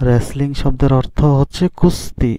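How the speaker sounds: ordinary talk, nothing marked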